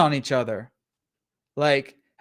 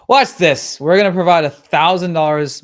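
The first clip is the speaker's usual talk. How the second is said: louder, punchy hype-man pitch; hyped pitch; 7 dB louder than usual. Acted. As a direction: sell it like a pumped-up marketer.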